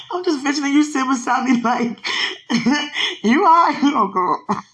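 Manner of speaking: high, loud words breaking into laughter; crying turned to laughter. Real, not acted. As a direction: laugh through the tears. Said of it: "She went from crying to laughing".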